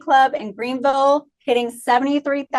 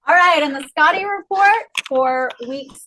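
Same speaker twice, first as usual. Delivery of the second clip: ordinary delivery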